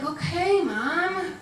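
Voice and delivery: lightly voiced daughter giving in; mock put-upon daughter. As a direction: imitate a kid humoring her mother. Daughter answers her bossy mom.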